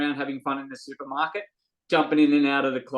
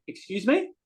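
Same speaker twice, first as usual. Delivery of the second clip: indignant excuse me; 3 semitones higher than usual. Retold or imitated. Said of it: "I'm like".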